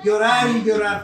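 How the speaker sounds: loud, high, drawn-out mock wail; hammed-up crying on a high pitch; wailing with feeling. Shown as a demonstration. Preacher shows congregation how the sheep cries, hammed-up wailing. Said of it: "denle feeling".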